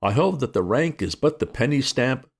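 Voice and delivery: plain reading voice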